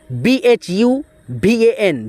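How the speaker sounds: slow, letter by letter; slow spelling. Shown as a demonstration. Teacher spelling a name for students, slowly letter by letter. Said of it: "teacher spells letter by letter".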